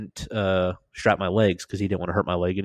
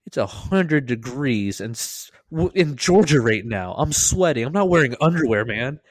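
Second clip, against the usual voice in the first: retold exasperation; 5 semitones higher than usual. Patient defending himself to medic, exasperated protest. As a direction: build into indignant insistence.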